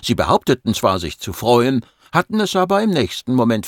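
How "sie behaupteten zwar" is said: ordinary delivery, nothing marked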